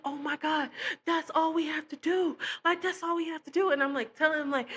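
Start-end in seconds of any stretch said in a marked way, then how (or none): 0.1-3.7 high, eager insisting; excited. Retold or imitated. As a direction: urge him on, pitched high with excitement.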